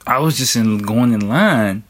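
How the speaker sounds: ordinary talk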